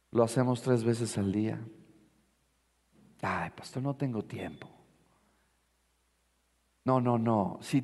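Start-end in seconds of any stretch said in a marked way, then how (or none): 3.2-4.7 soft, breathy mock complaint; imitating a tired churchgoer's whisper; whiny whispered excuse. Excuse voiced by a lazy believer.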